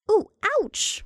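high sudden yelp of pain; sudden pained cry. Acted. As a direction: jolt out a sharp ouch. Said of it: "Martha suddenly exclaimed".

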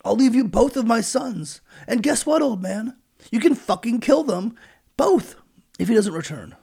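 loud, high, rising plea; pleading. Retold or imitated. Reuben begs his father, climbing to a high, loud plea. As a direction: push the offer harder and harder. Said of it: "Reuben tried to plead".

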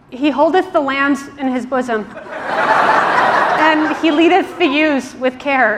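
slow, laughing fake King James; mock-solemn made-up verse, cracking up; slow, giggling pseudo-biblical recitation; laughing mock scripture.

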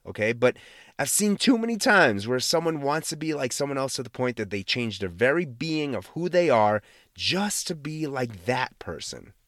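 ordinary delivery

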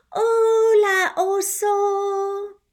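put-on a bright child saying hello to a bear; a hint of a little girl calling out; little girl's greeting.

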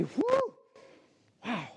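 high, breathy whew of relief; relieved whew. Retold or imitated. Sinner hearing the verdict, relieved whew. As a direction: let out a relieved whew, then wow. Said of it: "And I say".

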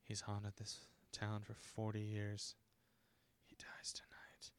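slow, very soft, ominous vow; mock dramatic whisper. Imitating the granddaughter holding a gun, hushed whisper. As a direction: whisper it slowly, overly solemn. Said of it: "the granddaughter's like".

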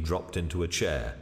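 ordinary delivery, nothing marked

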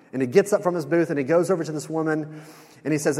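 ordinary talk, nothing marked

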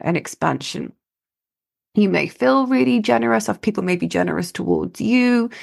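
normal talking voice

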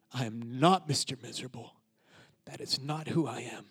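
low, measured and firmer, stress on NOT; retold vow, declared with quiet resolve; resolved declaration. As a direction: state it deliberately, pausing between sentences. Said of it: "hearing that to say".

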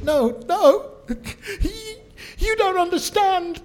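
hammed-up high, loud tearful wail; imitated sobbing fan. Crying fan meets the guest at the table, hammed-up sobbing wail. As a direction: build to an overwhelmed mock-crying shout. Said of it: "She's shaking, crying".

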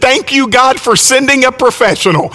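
high-pitched exclamation, no real shout; mock cry of thanks as the punchline; retold joyful exclamation. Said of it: "exclaimed at the top of her lungs".

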